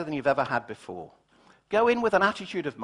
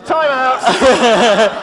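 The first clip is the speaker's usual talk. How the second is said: louder time out with a laugh in it; laughing shout; 5 semitones higher, 10 dB louder than usual. Real, not acted. Cutting off an audience member, loud and laughing. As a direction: shout it over the laughing room. Calling again over laughter.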